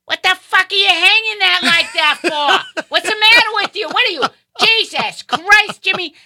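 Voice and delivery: high, loud brassy mom cursing out workers, laughing; hammed-up mom chewing out the guys, cigarette in hand; mock mother yelling. Mother yelling at the canopy crew.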